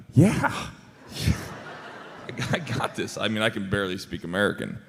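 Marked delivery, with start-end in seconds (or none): 0.1-3.2 a bright, high yeah, then a laughing, overconfident claim; cocky bluff. Retold or imitated. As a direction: fake confidence with a laugh. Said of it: "I'm like".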